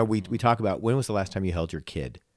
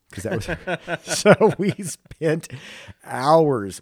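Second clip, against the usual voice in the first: high laughing burst, slowed; 8 semitones higher, 7 dB louder, much slower than usual. Real, not acted. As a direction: chuckle through the words. Laughing at childhood memory.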